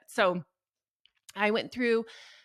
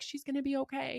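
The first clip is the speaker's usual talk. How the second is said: soothing reassurance; 8 semitones higher than usual. Retold or imitated. Doctors reassuring worried mother.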